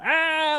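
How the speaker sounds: high sudden yelp out the car window; a quick high "Ah!" to spook him; mock scream at a friend. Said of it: "like this".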